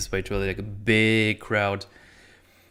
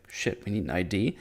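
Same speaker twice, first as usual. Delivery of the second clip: a soft, lower worried aside; muttered panic; 3 semitones lower, 8 dB quieter than usual. Retold or imitated. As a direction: mutter it as sudden realisation. Character realising, voiced low.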